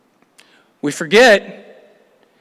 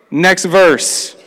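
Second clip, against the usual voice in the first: ordinary talk, nothing marked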